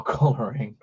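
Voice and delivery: usual speaking voice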